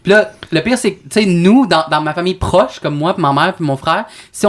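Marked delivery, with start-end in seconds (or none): none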